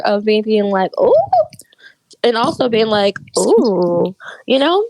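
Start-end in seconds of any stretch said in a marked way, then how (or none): 1.0-1.1 short ooh shooting up in pitch; a quick, rising sound of interest; intrigued ooh. Retold or imitated. Cohost replaying her mixed film reaction, intrigued. 3.4-4.1 drawn-out ooh, swooping up then falling; lingering ooh. Retold or imitated. Cohost replaying her other film reaction, lingering.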